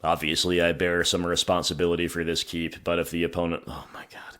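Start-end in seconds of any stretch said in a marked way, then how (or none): 3.7-4.4 whispered dismay. Real, not acted. Sees opponent's strong hand.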